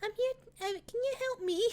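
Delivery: put-on a small lost girl greeting strangers; timid little girl. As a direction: ask shyly like a little girl. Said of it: "it's like".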